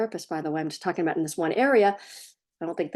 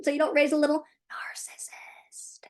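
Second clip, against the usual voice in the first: whispered aside; 7 semitones higher than usual. Real, not acted. Aside about narcissist.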